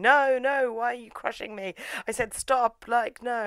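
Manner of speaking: high-pitched hammed-up pleading; mock panicked protest. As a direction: protest high, as if being crushed. Mimics man crushed by pole.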